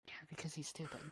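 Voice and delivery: quick unvoiced jab; whispered aside. Real, not acted. Whispered aside about him.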